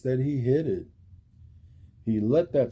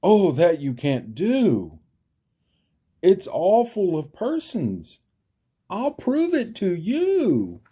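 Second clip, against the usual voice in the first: alarmed plea; 9 semitones higher, 5 dB louder than usual. A narrator's reading. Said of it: "gasped Horton".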